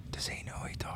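hushed, voiceless mock aside; imitated audience whisper. Comic voicing English audiences reacting to his accent, whispering. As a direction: whisper it like a puzzled onlooker.

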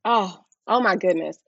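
usual speaking voice

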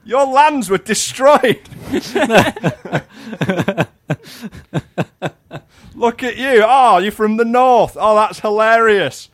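very high, loud sneering toff voice; mock snobby southerner. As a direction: taunt the northerners, laughing.

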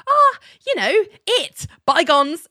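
playful high-pitched impression of a smooth talker dodging; evasive Richard. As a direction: brush it off in a high, breezy mock voice. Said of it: "And Richard's like".

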